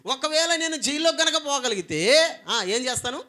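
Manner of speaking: loud, high preaching; preaching emphasis. Real, not acted. Preacher imagining prison, loud and high. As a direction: drive the line home.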